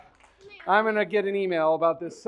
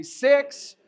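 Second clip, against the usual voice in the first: normal talking voice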